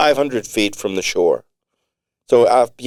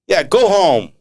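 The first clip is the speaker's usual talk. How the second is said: much higher, louder jeer; jeering dismissal; 10 semitones higher, 5 dB louder than usual. Acted. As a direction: back Tony up, telling them to get lost. Drake backs Tony on radio.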